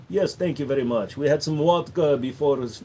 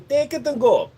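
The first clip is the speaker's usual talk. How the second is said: raised Indian accent, brisk; Indian shopkeeper accent; 9 semitones higher than usual. Shown as a demonstration. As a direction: hand it over, clerk-style. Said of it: "Almost at the very end".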